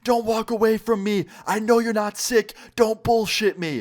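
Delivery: mock scream, pitched well up; retold scream. Ex screaming at him through the door, mock scream. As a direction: act out her high, insistent yelling. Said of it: "just pounding on it and screaming".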